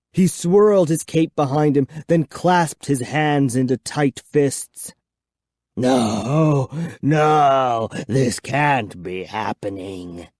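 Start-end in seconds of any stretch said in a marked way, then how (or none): 5.8-10.3 low, slow, dropping to a rasp; despairing old vampire groan; vampire lamenting in despair; hoarse vampire cry. A narrator's reading. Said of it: "he cried hoarsely".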